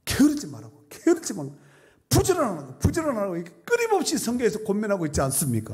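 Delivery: high, insistent pulpit repetition; urgent preaching exhortation. Real, not acted. Preacher urging the congregation to be diligent, urgent exhortation. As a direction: drive each repeated command into the congregation.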